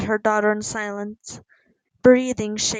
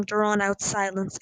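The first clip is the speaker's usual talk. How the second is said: ordinary delivery, nothing marked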